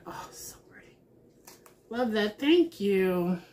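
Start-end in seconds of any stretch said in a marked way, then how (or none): 0.1-1.0 1 whisper. Real, not acted. Admiring card under her breath.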